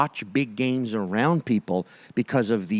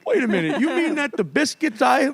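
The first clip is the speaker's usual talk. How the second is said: high, incredulous hero voice; mock reluctant hero; 11 semitones higher than usual. Teasing Mike as the bewildered chosen hero. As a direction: sound baffled at being chosen to save the world. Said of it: "that vibe and that look".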